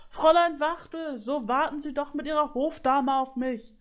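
high, pleading shout from behind; calling out. A narrator's reading. Griffin begs the goose ladies to wait, calling out. Griffin calls after fleeing geese.

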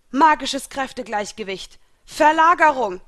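loud, high battle cry; called-out spell. A narrator's reading. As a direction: shout the spell, building to a big final word. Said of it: "rief Libra". (laut gerufener Zauberspruch)

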